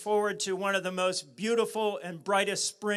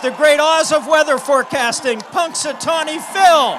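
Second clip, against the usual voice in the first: booming ringmaster introduction, higher and louder; announcer voice; 6 semitones higher, 12 dB louder than usual. Acted. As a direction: announce him like a showman. Grand announcement of Phil.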